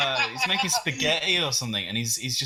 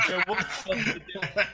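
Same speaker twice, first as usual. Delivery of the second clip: mock wife; 7 semitones higher than usual. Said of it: "his wife's going".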